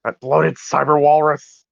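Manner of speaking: slow villain sneer; villain insult. Acted.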